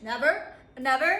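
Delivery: loud, defiant repeat of never; stubborn loud declaration. Acted. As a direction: plant your feet and refuse loudly. Defiant refusal to give up.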